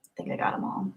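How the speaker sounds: very soft whisper; whispering to oneself. Real, not acted. Streamer checking for missed super chats, whispering to herself. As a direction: murmur it voicelessly to yourself.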